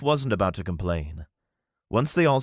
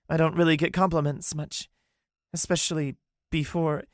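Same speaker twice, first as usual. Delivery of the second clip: higher, modest and hesitant; lightly voiced shy Callie; 8 semitones higher than usual. Callie admitting she rarely gets compliments. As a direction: brush off the praise.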